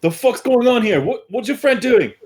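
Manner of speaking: baffled Vance. Retold or imitated. GM voicing Vance asking what his friend is doing, lightly baffled. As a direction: toss it off like a rattled bystander. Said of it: "He's currently kinda like".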